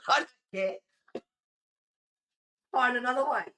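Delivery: loud, high and emphatic; bitchy retold refusal. Streamer re-enacting refusing a woman's plea, loud bitchy refusal. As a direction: raise your voice and dismiss them. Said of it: "I was a bit of a bitch".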